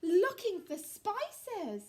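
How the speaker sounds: hammed-up alarm; shocked discovery. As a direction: spell out the horror slowly for children. Teller gasps at alarming discovery.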